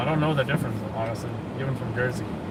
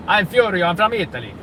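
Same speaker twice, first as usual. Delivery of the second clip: loud, sing-song Italian accent; high, loud Furio-style Italian accent; 8 semitones higher, 9 dB louder than usual. Shown as a demonstration. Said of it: "Italian accent is like".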